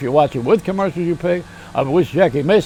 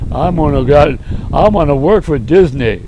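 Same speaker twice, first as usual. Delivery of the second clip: Brer Bear again; 3 semitones lower, 6 dB louder than usual. Retold or imitated. Storyteller's bear character blurts out a joke, loud bear voice. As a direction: boom it like the dumb bear. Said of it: "I do the impressions of".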